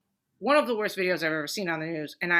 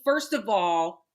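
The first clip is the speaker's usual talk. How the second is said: ordinary delivery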